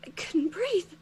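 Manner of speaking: high, small, panicked voice; breathless panic. Acted. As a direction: squeak it out short of air. Student just gasped, struggling to breathe.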